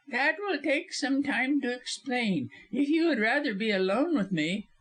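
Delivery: very high, wheedling lawyer voice; high-pitched scheming villain; sly insinuation. A narrator's reading. Lawyer insinuates after titter.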